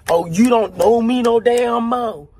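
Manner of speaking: loud, put-out woman confronting him; imitated confrontation. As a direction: confront an old date, loudly. Girl confronts him at mall.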